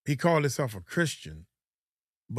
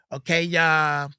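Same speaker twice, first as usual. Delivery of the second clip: higher, louder put-on YouTuber voice; mock the fake on-camera hype host; imitating a ratchet YouTuber intro; 4 semitones higher, 4 dB louder than usual. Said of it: "they sound so, what, ratchet?".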